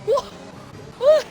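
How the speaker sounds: high astonished question; surprised. Real, not acted. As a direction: sound stunned. Shock at race turn.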